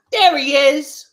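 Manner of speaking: high, loud pointed call-out; mock accusing lady. The lady announcing him to the staff, high and loud. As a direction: sing it out high like she's spotted the culprit. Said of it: "she goes like this".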